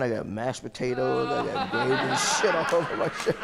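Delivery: telling it through laughter; words breaking up with laughter; laughing. Real, not acted. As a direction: crack up while finishing the funny story. Laughing at his own messy story.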